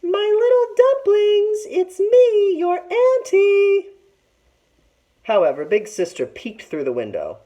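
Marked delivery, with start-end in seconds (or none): 0.0-3.9 very high, slow sing-song falsetto. A narrator's reading. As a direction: squeak it out like a sweet old auntie. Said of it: "he said in a high, high voice".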